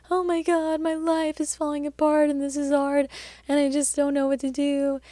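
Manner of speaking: high, overwrought mock despair, pitch shooting up; mock panicked wail. Telling her mentor her life is falling apart, in a hammed-up high wail. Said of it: "because I'll be like".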